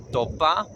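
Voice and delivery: topa starting between a T and a D; soft T. Shown as a demonstration. Teacher giving Lakota word for four, T-D blend. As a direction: begin topa on a sound halfway from T to D. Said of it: "somewhere between a T and a D".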